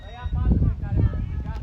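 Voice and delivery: insistent shout. Real, not acted. Coach summons the four players, insistent shout. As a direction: raise the voice so they all hear. Repeats call to named four.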